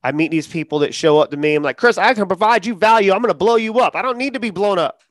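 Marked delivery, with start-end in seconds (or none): 1.8-5.0 a mocking, higher hype-man voice; mock hustler. Mocking a stranger who pitches him online, higher and hyped. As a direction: parody a pushy self-promoter.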